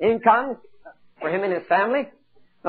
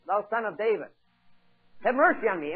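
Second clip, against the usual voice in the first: normal talking voice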